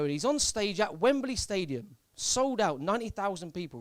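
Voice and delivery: ordinary talk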